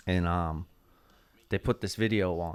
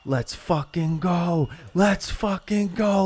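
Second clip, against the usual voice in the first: high, slow, hyped-up yell of let's go; hyped shout; 8 semitones higher, slower than usual. Retold or imitated. Pumped crew shouting themselves up before the stage, slow high yell. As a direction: pump the crew up before going on. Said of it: "we go from sobbing to".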